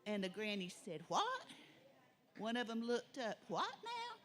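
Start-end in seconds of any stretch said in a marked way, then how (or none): 1.1-1.5 shrill high "What?"; startled granny. A narrator's reading. Granny hearing of the gold toilet, high startled cry. 3.5-4.2 high, soft disbelieving "What now?"; shocked granny. A narrator's reading. Granny asking what the boys just said, high soft disbelief.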